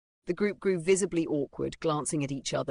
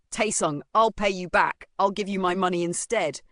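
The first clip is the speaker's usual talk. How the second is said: louder, higher, urgent plea; desperate blurting; 4 semitones higher, 6 dB louder than usual. A narrator's reading. Boy offers the bully his money, blurting desperately. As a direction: blurt it out, begging him. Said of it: "Desperate, Hung Suk blurted out".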